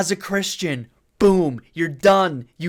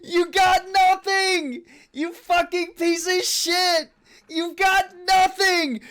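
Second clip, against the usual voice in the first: high, gleeful taunting yell; 13 semitones higher than usual. Real, not acted. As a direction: crow over the loser. Gloating over a beaten debater.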